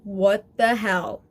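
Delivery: imitating Summer. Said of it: "Like that one scene in Rick and Morty where Summer's like".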